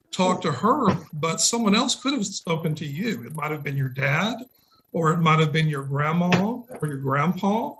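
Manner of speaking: ordinary delivery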